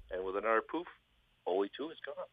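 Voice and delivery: normal talking voice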